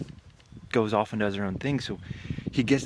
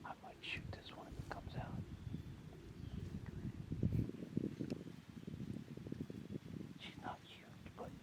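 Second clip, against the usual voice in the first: whispering; 12 dB quieter than usual. Real, not acted. Whispering near deer before second shot.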